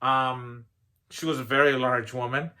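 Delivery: ordinary delivery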